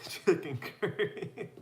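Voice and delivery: reads it out through a real laugh; can't get through the question without cracking up; laughing disbelief. Real, not acted. Laughing at a viewer's odd question.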